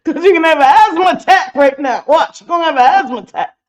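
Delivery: can barely get the words out through laughter; laughing. Real, not acted. Host laughing at viewers over a raging clip, cracking up.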